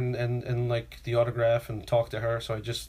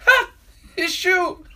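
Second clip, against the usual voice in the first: high, loud mock cry; friend's outburst; 7 dB louder, much slower than usual. Friend blurting out in a silent movie theater, high outburst. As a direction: burst out with it, high and sudden. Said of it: "she goes".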